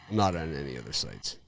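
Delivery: usual speaking voice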